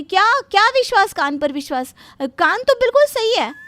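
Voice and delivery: high and loud, flustered outrage; loud, bewildered bride defending her ears; shocked protest. Acted. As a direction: protest that your ears are perfectly fine.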